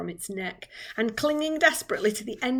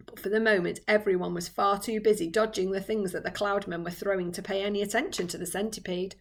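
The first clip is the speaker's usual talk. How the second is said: ordinary delivery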